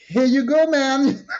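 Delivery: high, loud, teasing re-enacted reply; playful exclamation. Woman replying to man who won't let her go, playful exclamation.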